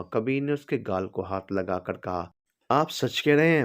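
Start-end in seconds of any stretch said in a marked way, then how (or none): 2.7-3.6 high, eager disbelief; bright eager question. Acted. Drunk wife asking husband, eager and hopeful. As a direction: ask with sudden hopeful excitement. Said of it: "आंखों में चमक लाते हुए पूछा".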